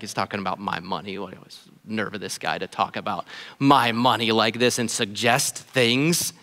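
mock the listener recoiling about money, low and hushed; resentful churchgoer. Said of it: "you're like".

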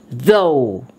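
Though. with a buzzing voiced th at the start; Though. starting with voice on the th, not just air; sounded th in Though. Shown as a demonstration. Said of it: "Meaning there will be sound".

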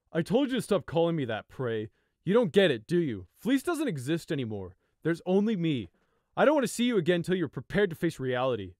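put-on loud, furious older brother; spat in anger. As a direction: spit the words at him. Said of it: "Fleece spat".

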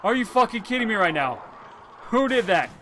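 high, loud, fast frightened yelling; panicking gamer. Real, not acted. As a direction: scream it out in real fright. Big jump scare in game.

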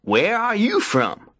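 high-pitched friendly rabbit voice; Brer Rabbit. A narrator's reading. Rabbit chats to the silent tar baby, high and friendly. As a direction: introduce yourself as a high-voiced little rabbit. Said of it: "said Brer Rabbit".

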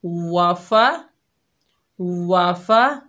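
वाफा with rounded lips on the वा; slow, round-lipped वाफा. Shown as a demonstration. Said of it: "होंठों को गोल करेंगे".

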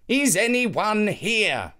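slow, searching call into the dark. A narrator's reading. Anansi calling into the empty hole, slow and searching. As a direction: call out slowly, unsure who is there. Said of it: "calling out".

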